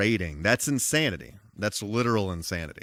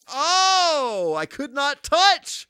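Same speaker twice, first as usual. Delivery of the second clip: high, slow, louder protest; high exclaim; 5 dB louder, much slower than usual. Real, not acted. Repeats the line, fading.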